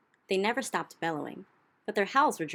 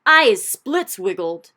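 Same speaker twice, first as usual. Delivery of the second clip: slow, much louder yell of a giant; 3 semitones higher, 15 dB louder, slower than usual. Acted. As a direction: bellow it out slowly in a giant's voice. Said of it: "yelled the child chewer".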